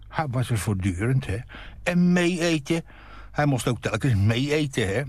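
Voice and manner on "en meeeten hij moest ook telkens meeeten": mocking stretch. Mocks boyfriend staying to eat.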